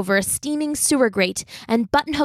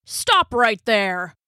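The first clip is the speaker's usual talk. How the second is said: cop voice; much slower than usual. Acted. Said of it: "said the cop".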